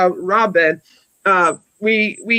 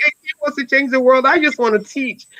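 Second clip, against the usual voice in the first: mocking friend again; 5 semitones higher than usual. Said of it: "She was like".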